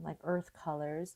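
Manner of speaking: normal talking voice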